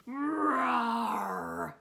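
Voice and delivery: long rolling storybook roar; drawn-out growly roar; lion roar. Acted. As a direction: roar like an unseen beast. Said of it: "Who's that roaring at my door?".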